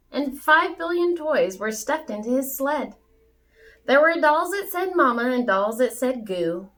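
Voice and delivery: lively storybook read-aloud; story narration. A narrator's reading. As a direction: read it bouncy for children. Reads a rhyming Christmas story aloud.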